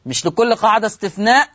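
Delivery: usual speaking voice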